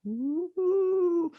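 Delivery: high, rising spooky moan; ghostly ooh. Retold or imitated. Mysterious ghost noise in the car, high moan.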